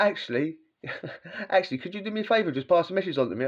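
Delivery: high, quick mock-polite request; sarcastic politeness. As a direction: fake courtesy with a laugh in it. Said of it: "I'd be like".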